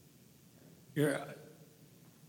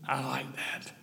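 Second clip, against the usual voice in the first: whisper; 10 dB quieter than usual. Real, not acted. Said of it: "whispered aside".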